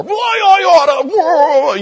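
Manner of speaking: high, loud, slow stretched-out threat; hammed-up angry yell of a shaking fist; mock furious shout. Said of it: "he yelled at me some more".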